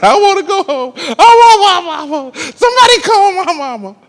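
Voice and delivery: high, laughing mock sob of a little boy; mock crying child. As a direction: whimper for mama like a homesick kid. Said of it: "I cried all weekend".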